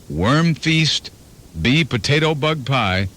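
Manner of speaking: usual speaking voice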